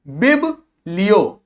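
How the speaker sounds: बिब लियो said slowly as bib, pause, li-yo; slow syllable-by-syllable बिब लियो; split syllables. Shown as a demonstration. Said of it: "शब्दों को तोड़ तोड़ के पढ़िए".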